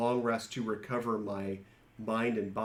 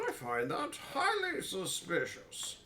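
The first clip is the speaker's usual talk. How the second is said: higher, wary character voice; suspicious character voice; 9 semitones higher than usual. Acted. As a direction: raise the pitch, sound wary.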